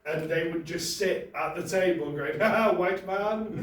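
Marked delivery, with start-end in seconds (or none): 2.4-3.6 slow, louder mock Zambian accent. Siblings mocking her stirring, in a Zambian accent. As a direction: drawl it out in a Zambian accent, teasing. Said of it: "I said I wasn't gonna do the accent".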